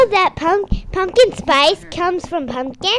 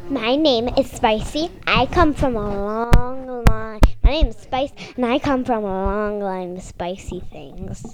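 playful deep-voiced persona; mock solemn introduction; 8 semitones lower, 4 dB quieter than usual. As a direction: introduce yourself with a lowered voice, stretching the words.

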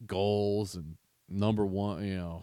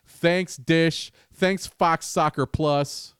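sarcastic thanks; 8 semitones higher, 6 dB louder, much slower than usual. Real, not acted.

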